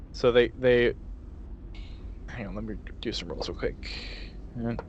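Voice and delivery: ordinary delivery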